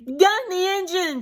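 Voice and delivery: put-on high, cheering shout of kids. A narrator's reading. Kids on the bus cheer Annie on, shouting. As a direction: egg the driver on like a busload of kids. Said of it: "shouted the kids".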